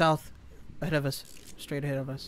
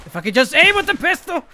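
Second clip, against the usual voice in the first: high, loud frustrated shout; frustrated yell; 11 semitones higher, 10 dB louder than usual. Real, not acted. Frustrated gamer outburst.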